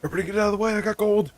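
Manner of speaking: very high, loud, panicked cartoon shout; frantic gold-rusher. Streamer acting out the winner rushing for gold, frantic high shout. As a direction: scream it like a greedy goblin shoving through a crowd. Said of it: "I read that as, like".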